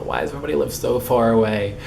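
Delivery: ordinary talk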